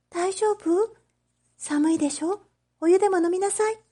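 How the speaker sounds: put-on high, light young girl, quick and kind; a young shopgirl fussing over a cold child; gentle girl's voice. Oshichi offering hot water to the freezing boy, high and kind.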